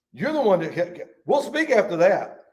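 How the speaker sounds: normal talking voice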